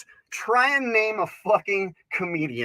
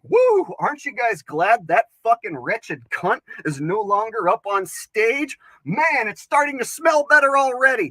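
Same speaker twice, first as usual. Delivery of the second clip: loud, high whoop then gloating; cheering male comic; 5 semitones higher, 6 dB louder than usual. Retold or imitated. Comic voicing the next male comedian, loud and gloating. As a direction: gloat it like a crowd-pleasing comic. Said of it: "he can get up on stage and be like".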